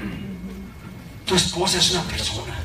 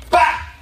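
gunshot imitation; 9 semitones higher than usual.

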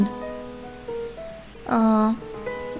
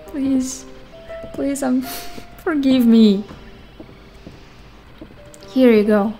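ordinary talk, nothing marked

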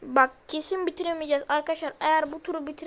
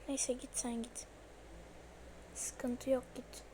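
much softer, hushed; 2 semitones lower, 11 dB quieter than usual. Real, not acted. Gamer hiding from Granny, hushed.

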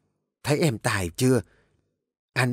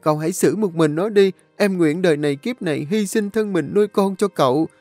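high, whining, tearful plea; cornered girl begging the young master, wailing; wailing loudly; 8 semitones higher, 3 dB louder than usual. A narrator's reading. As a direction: wail and blame the other man. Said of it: "nó liền bù lu bù loa".

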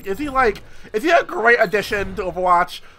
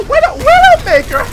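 very high, louder greeting; squeaky taunt; 7 dB louder than usual. Pretend. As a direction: squeal her name in a hamster voice. Spots enemy, squeaky voice.

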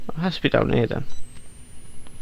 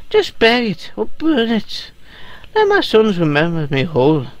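put-on Irish accent; 2 semitones higher than usual. Reading a dying person's note aloud to Lisa, Irish accent. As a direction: read it aloud in a wandering, lilting Irish accent. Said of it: "my accent sort of went to the Irish".

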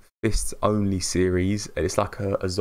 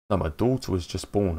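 ordinary delivery

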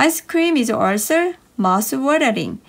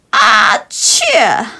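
loud shouted sneeze; big sneeze; 8 dB louder than usual. Acted. A big sneeze bursts out, loud. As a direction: explode into a huge achoo.

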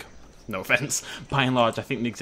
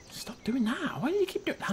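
high, softer exasperated plea; exasperated whine; 14 semitones higher, 5 dB quieter than usual. Real, not acted. Player to the misbehaving game, exasperated whine. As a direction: plead in a high, small voice.

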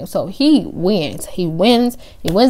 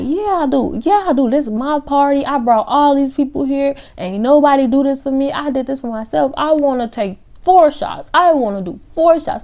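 put-on drunk insistence; 7 semitones higher than usual. Drunk girl insists on shots.

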